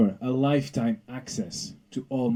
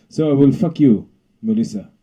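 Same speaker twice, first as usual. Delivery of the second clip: put-on Zeus in a hammy foreign accent; mock foreign accent; 3 semitones higher, 7 dB louder than usual. King of the gods mocking Melissa's accent. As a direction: boom it out as a grand foreign god. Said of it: "Are you mocking my accent".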